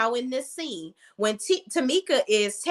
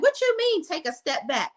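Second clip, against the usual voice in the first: mock upset mother; 8 semitones higher than usual. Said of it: "the mother gets upset and she's like".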